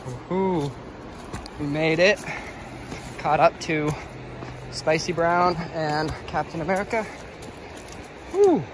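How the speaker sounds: celebrating. Real, not acted. Hiker celebrates catching up to the others, elated. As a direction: whoop and call out the arrival.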